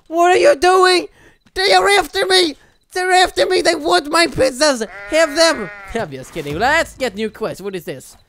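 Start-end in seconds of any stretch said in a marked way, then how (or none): 0.1-5.7 high, hurried panicked cry; mock panic. Gamer fleeing pizza-hungry enemies, high panicked cry. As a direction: yelp like they're on your heels.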